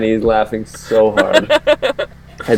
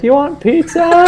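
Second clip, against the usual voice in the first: high-pitched mock yell; mock mom yell. Buddy's mom offering food down the stairs, high mock yell. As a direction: call down in a high imitation mom voice. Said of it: "yell down".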